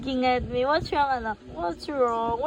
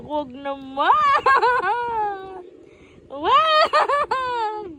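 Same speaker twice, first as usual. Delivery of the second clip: being tickled; 9 semitones higher, much slower than usual. Real, not acted. Baby tickling mom.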